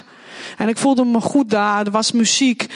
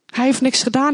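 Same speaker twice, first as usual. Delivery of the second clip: usual speaking voice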